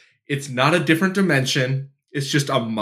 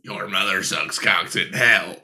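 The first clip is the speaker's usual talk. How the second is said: mock demon voice; 6 semitones lower than usual.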